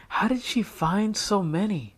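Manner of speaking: put-on wondering girl. A narrator's reading. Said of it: "wondered Jessica".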